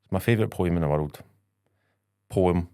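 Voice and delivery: normal talking voice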